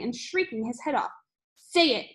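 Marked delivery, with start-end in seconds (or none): 1.6-2.1 loud, high demand; bellowed order. A narrator's reading. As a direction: shout the order at the pupil. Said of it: "bellowed the Trunchbull".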